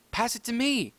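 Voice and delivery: high, loud call for the ball; a pup calling for the ball. Acted. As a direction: shout for a pass.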